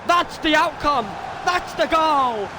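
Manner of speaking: high, drawn-out play-by-play shouting; ecstatic goal call. Real, not acted. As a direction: call the winning goal at full voice. Calls decisive goal live.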